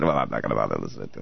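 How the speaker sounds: low, quick, careless dismissive mumble; lazy voice. Retold or imitated. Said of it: "you just got lazy and said".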